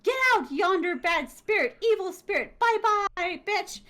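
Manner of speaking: high, loud mock exorcism; hammed-up banishing. The writer sending the evil spirit out of the house, high and loud, hammed-up. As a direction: shoo the spirit off theatrically.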